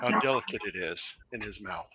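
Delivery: hushed nature documentary narrator; low, quiet wildlife narration. Pretend. Mock wildlife show about eating.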